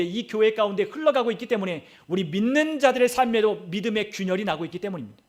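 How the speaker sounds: usual speaking voice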